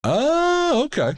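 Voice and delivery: high startled oh; surprised. Real, not acted. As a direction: jump up in pitch, surprised. Reacting to goat blood.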